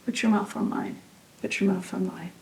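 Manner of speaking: a hushed whisper, barely voiced. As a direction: lean in and whisper it close. Intimate repeated plea.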